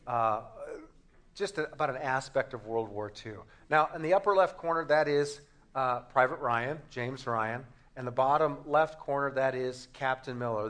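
normal talking voice

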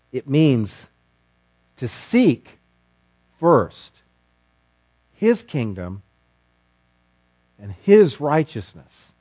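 long pauses between "to seek", "first", "his kingdom"; measured, weighty reading; slow deliberate phrasing. Shown as a demonstration. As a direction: lay out each phrase separately. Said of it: "slow reading of the verse".

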